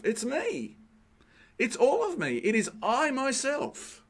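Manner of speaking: "It is I myself" with each word hit high and hard; emphatic. Shown as a demonstration. Preacher driving home Jesus's words, emphatic and high. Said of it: "That's emphatic, isn't it".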